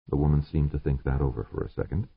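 plain reading voice

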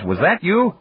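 high-pitched, feigned disbelief; mock surprise. A narrator's reading. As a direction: ask it as if astonished, teasing. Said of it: "said with mock surprise".

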